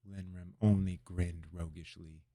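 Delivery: usual speaking voice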